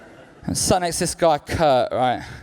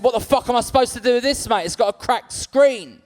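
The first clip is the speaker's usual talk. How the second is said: higher, disgusted outburst; mock outraged mugger; 9 semitones higher than usual. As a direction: play the mugger appalled by the phone. Mugger disgusted at old phone.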